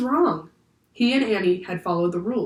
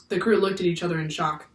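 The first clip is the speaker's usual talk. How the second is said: usual speaking voice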